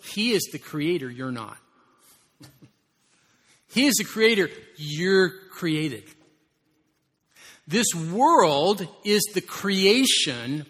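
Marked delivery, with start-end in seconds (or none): 3.7-10.6 higher and louder, hammering the point; emphatic preaching. Real, not acted. Pastor booming to the congregation, emphatic declaration. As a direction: declare it boldly from the pulpit.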